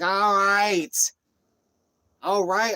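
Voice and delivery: high, loud mocking cop "all right". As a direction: ham up the cop brushing him off. Cop voiced in retold encounter.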